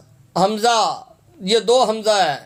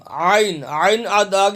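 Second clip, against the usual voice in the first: ordinary talk, nothing marked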